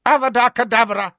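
high, loud, fast incantation; shouted curse. A narrator's reading. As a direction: fire the spell out suddenly. Voldemort casts the killing curse.

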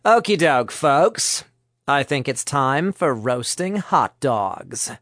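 ordinary delivery

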